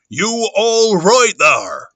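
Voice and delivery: slow, drawn-out loud rustic call; Mister Hall. Acted. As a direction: stretch every word, calling high and loud. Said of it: "Okay, Mister Hall".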